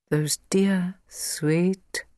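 slow, forced endearment; measured self-restraining mutter; talking to himself. A narrator's reading. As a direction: say it slowly, correcting yourself. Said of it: "said Mister Privy to himself".

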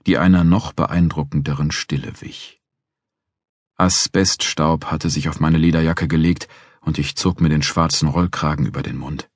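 ordinary delivery

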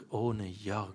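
low, very soft repeat; hushed. A narrator's reading. Narrator savouring life without Jörg, hushed. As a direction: savour it quietly, voice dropping. Closing repetition fading away.

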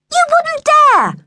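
very high, slow, loud challenge; high-pitched scandalised holler; outraged sister shout. A narrator's reading. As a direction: yell it in shrieked disbelief. Sister shouts back at brother.

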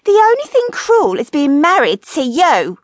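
high, loud bitter comeback; high-pitched comic indignation; quarrelling wife. A narrator's reading. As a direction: fling it back as a shrewish wife. Wife snaps back in marital quarrel.

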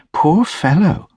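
high lady's voice, full of pity. A narrator's reading. Lady pitying the hunched man on the seat, high and sympathetic. Lady pities Mister Hackett.

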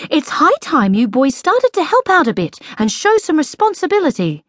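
high-pitched lecturing tone; scolding mother. A narrator's reading. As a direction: tell the boys off, emphatically. Mother lecturing her boys.

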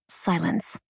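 ordinary delivery, nothing marked

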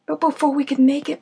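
plain reading voice

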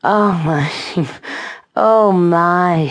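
drawn-out, breath-filled moan. A narrator's reading. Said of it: "she moaned".

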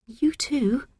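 small, high, breathy reply; timid high-pitched schoolgirl; shy. A narrator's reading. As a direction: answer shyly to a new friend.

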